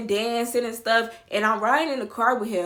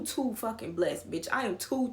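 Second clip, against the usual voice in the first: usual speaking voice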